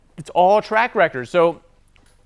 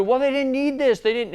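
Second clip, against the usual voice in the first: nitpicking excuse; 9 semitones higher than usual. Retold or imitated. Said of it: "he's, like, nitpicking".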